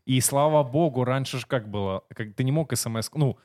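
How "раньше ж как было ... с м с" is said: ordinary talk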